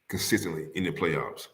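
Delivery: "Consistently in the playoffs" murmured low under the breath; quiet echo. Shown as a demonstration.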